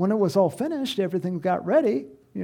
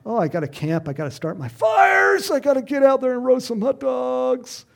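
excited; 6 semitones higher, 4 dB louder than usual. Retold or imitated. Excited list of plans.

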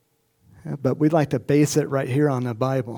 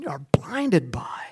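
slow whisper; 4 dB quieter, much slower than usual. Retold or imitated. Rebuke drops to whisper.

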